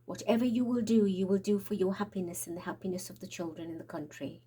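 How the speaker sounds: ordinary delivery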